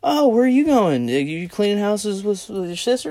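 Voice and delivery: high-pitched mock lady voice; nosy ladies. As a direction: imitate women quizzing the newcomer. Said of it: "they're all asking me".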